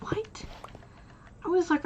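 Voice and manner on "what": incredulous what. Retold or imitated.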